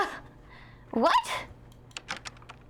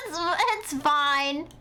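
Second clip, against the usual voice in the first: laughing; 6 semitones higher, much slower than usual. Real, not acted. Laughs at her messy writing.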